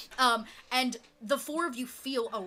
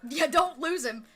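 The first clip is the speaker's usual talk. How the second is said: louder, higher, emphatic caution; firm warning; 4 semitones higher, 6 dB louder than usual. Real, not acted. Game master warning player about the pebble, emphatic. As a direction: warn them with a raised voice.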